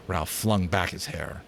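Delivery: ordinary delivery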